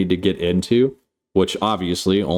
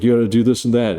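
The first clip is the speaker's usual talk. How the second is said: ordinary talk, nothing marked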